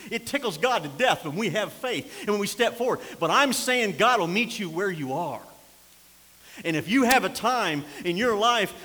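loud, high, hammering sermon delivery; preaching at full force. Acted. Preacher warning the congregation about returning spirits, full-force preaching. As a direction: preach it like a revival. Preacher voicing a desperate father.

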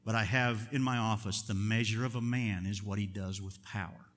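normal talking voice

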